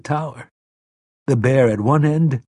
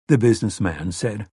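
ordinary delivery, nothing marked

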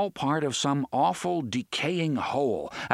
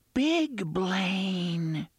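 put-on high, slow child whisper; a hidden child whispering a secret; eerie ghost child; 8 semitones higher than usual. A narrator's reading. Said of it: "the unseen voice whispered".

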